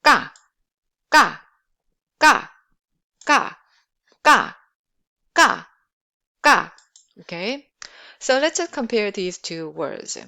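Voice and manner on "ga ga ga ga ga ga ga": "Ga" loud and short, repeated with gaps; hard, tight k in each "ga"; tense k. Shown as a demonstration. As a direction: pop out "ga" from built-up air, no puff. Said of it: "that is a sound".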